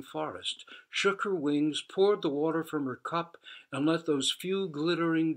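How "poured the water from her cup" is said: usual speaking voice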